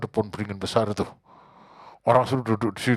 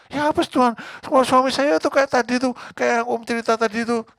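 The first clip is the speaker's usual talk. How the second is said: very high, loud, whining complaint; shrill mock housewife voice; whiny wife; 12 semitones higher, 5 dB louder than usual. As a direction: play the exasperated wife making excuses. Woman whining to God.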